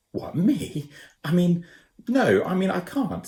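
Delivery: put-on high, flustered boy voice; boy Ben, flustered. A narrator's reading. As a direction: squirm out of the job, panicky. Ben's voice in dialogue.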